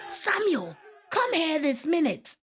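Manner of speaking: very high-pitched scolding teacher's call; stern teacher summons. A narrator's reading. Ghanaian teacher calling pupil out of trouble, stern summons.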